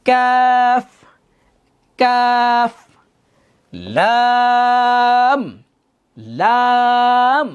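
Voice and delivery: slow, drawn-out Arabic letter names; slow, held Quran letter names; letter names drill. Shown as a demonstration. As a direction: stretch each letter name like a teacher. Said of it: "আমার মুখের দিকে খেয়াল করেন".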